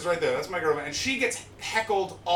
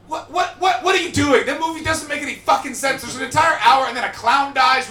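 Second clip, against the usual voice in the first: high, loud, exasperated yelling; shouting in disbelief at the crowd; incredulous shouting rant; 8 semitones higher, 7 dB louder than usual. Real, not acted. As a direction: blow up at how absurd the movie is. Ranting on stage about Scarface.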